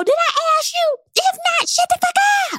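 high, sharp mock retort; sassy high retort. Pregnant host snapping back at commenters, pitched high. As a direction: squeal it at the critics. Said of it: "makes me go".

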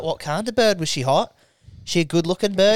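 nosy English mates in the pub; put-on English lad's accent; English pub bloke. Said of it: "And they'd be like".

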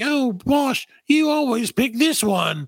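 put-on high, drawn-out British girl voice; mock British accent. Friends teasing Posh over her dress, mock British voice. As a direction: tease in a hammed-up British accent. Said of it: "they all have different accents".

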